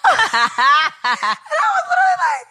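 disbelieving laughing yell; laughing shout. Real, not acted. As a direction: cry it out through laughter. Laughing retelling shock.

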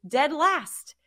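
ordinary talk

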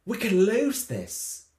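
drawn-out, hammed-up British accent; mock British accent. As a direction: mimic an actor slipping into British, half laughing. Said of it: "He got so British there".

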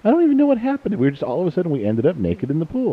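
squeaky high, faux-clueless girly voice; mock innocent. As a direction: play dumb in a falsetto, mocking the daughters. Dad mocks daughters' innocence.

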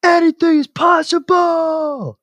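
long, slow, high screaming roar; imitated scream. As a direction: scream it like you just won the title. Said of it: "And he screams".

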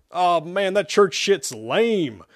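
high, drawn-out scornful complaint; scoff, dragging out the words. Acted. Shepard dismisses the church chant, scoffing. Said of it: "Shepard scoffed".

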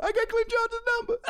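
very high, loud fan squeal; retold over-excited outburst; giddy shriek. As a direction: crow it in a starstruck high voice. Retold thrill at getting idol's number.

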